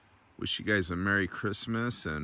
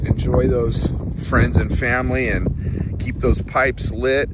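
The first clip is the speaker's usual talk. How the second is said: loud heartfelt send-off; 4 semitones higher, 9 dB louder than usual. Real, not acted. Heartfelt sign-off blessing.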